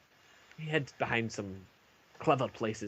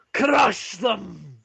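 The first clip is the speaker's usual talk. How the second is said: louder, higher, firm declaration; firm loud echo; 9 semitones higher, 10 dB louder than usual. Acted. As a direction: nod to Ulfric and declare it. Turek echoes Ulfric's cry.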